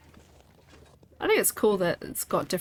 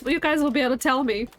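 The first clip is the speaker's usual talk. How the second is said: ordinary talk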